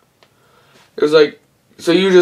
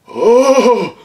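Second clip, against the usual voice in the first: surprised cry; 8 semitones higher, 3 dB louder than usual. Real, not acted. Exclaims at couch joke.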